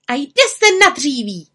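high, loud rallying cry; spirited little witch exclamation; calling out. A narrator's reading. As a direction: call the start of the work. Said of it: "zvolala a pronesla zaklínadlo".

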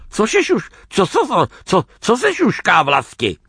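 high, stammering, desperate plea; pleading. A narrator's reading. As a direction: beg to be told, tripping over the words. (úpěnlivá, zakoktaná prosba)

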